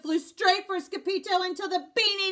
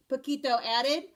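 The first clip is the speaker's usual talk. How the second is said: usual speaking voice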